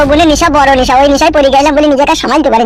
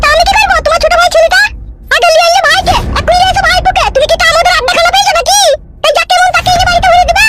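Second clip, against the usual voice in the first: high-pitched nagging wife voice; angry housewife, pitched way up; scolding wife. Acted. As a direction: rail at your useless husband in a high voice. Wife rejects the guests.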